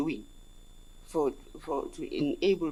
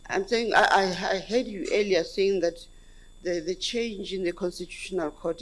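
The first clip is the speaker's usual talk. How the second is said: raised voice; 3 semitones higher, 6 dB louder than usual. Real, not acted. Commissioner repeats the question after being asked to speak up, raised voice. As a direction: speak up so the room can hear. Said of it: "Maybe you might raise your voice".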